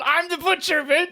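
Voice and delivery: high, loud mock tough-guy reveal; mock villain line. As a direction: sneer it like a cartoon villain. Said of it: "He might as well just said".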